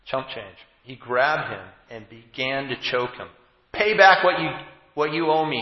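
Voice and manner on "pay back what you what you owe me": raised, higher demand for payment. A narrator's reading. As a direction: servant demands the debt from his fellow servant, forcefully. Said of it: "he demanded".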